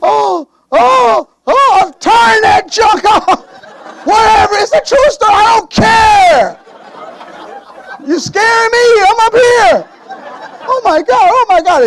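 hammed-up scared shouting; mock panicked yelling. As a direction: shriek in fake terror at the movie. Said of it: "and I'm upstairs".

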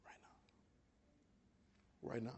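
barely audible, very soft words; hushed urging. Real, not acted. Preacher after a long silence urging right now, hushed. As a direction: breathe it out almost silently.

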